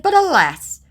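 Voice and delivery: hammed-up tragic sigh; mock dramatic. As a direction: ham up the alas.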